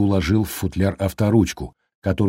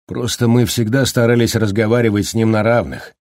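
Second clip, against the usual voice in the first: usual speaking voice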